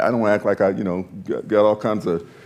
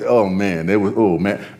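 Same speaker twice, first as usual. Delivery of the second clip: normal talking voice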